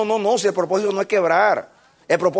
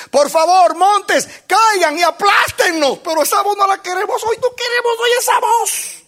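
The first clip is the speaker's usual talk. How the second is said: much higher, pleading to the mountains; desperate plea; 12 semitones higher, 4 dB louder than usual. Acted. Sinners begging the mountains to crush them, high and desperate. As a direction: beg the mountains to fall on you.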